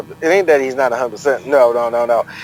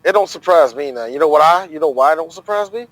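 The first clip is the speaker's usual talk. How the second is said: ordinary talk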